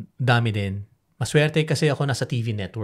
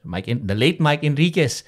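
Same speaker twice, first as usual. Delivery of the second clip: ordinary talk, nothing marked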